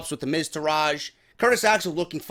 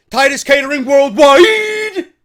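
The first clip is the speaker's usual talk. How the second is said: big hammed-up shouted slogan, higher and louder; mock announcer shout; 8 semitones higher, 9 dB louder, slower than usual. Mocking Titus Catering to viewers, hammed-up shout. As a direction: belt it like a ring announcer.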